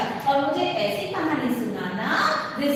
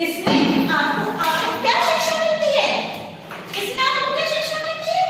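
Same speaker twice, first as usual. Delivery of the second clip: high-pitched, incredulous questioning; exasperated teacher; 13 semitones higher than usual. Acted. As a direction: demand the moral in a raised, exasperated pitch. Teacher dismayed at child's pointless story. (झुंझलाई हुई teacher, ऊँची आवाज़)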